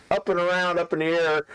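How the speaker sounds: ordinary talk, nothing marked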